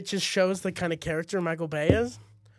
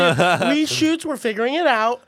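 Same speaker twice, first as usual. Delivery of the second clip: high, louder mock-upbeat assistant; forced cheer; 9 semitones higher, 7 dB louder than usual. Assistant covering up Transformers trouble to students, high forced cheer. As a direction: fake that everything is fine.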